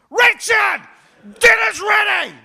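loud shout from another room; calling out. Retold or imitated. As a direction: shout the dinner call. Wife calling from kitchen.

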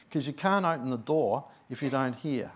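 usual speaking voice

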